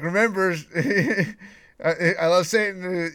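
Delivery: imitating the killer with a chuckle; mock killer quote. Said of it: "he was like".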